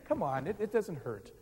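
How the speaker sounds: ordinary delivery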